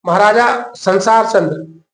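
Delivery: slow, drawn out, "संसार" leaned on with a falling tune; slow teaching stress. Shown as a demonstration. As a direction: spell out "महाराजा संसार चंद्र" slowly so students remember it. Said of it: "Patna का, पटना में".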